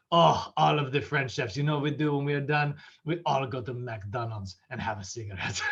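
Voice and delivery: hammed-up French chef accent; terrible French accent. French chef telling dinner guests about after work, bad French accent. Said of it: "I have a terrible French accent".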